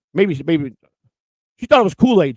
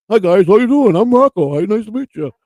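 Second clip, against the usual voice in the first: louder, higher meet-and-greet voice, imitating Rocco; Rocco at work; 5 semitones higher, 5 dB louder than usual. Host mimics Rocco introducing himself at his regular job, mock-friendly. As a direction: put on an eager-to-greet Rocco, pitched up. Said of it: "when he goes to work with his regular job".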